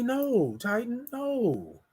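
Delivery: a quiet headshake in the voice; God quietly refusing Titan's plea; gentle no. Retold or imitated. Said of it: "God told me".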